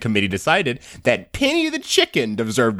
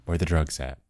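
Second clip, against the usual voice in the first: low, softer slang delivery; mock slangy; 10 semitones lower, 6 dB quieter than usual. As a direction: drop it low and cool.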